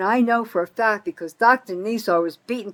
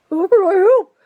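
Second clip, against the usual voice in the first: much higher and louder sneering parody; louder mock mayor; 12 semitones higher, 10 dB louder than usual. Mocking the mayor again, even higher and louder sneer. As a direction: hammer the fake offer even harder. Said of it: "Well, Kramer".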